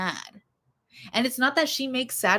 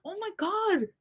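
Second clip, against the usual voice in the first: much higher, quieter gasp of disbelief; a hushed high "oh my God" at her own past; mock horrified exclaim; 11 semitones higher, 7 dB quieter than usual. Recalling old lyrics, a high hushed gasp. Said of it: "and it's like".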